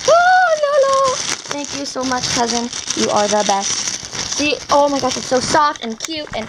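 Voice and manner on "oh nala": gasp, then a very high, loud squeal of the name; real giddy gasp and squeal; thrilled squeal. Real, not acted. First sees the toy, names it.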